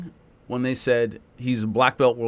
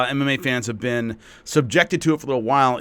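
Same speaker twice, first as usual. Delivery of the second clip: usual speaking voice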